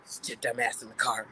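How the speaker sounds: low, soft, firm order; stern homeboy. Retold or imitated. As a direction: quietly lay down the law, voice dropped.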